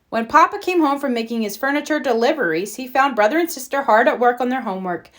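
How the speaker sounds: usual speaking voice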